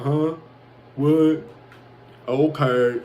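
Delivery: mock slow-witted Southerner, lightly voiced; short puzzled replies as a mock Southerner; confused Southerner. Mimicking baffled people down south who can't follow his words, confused Southerner. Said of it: "people down south be like".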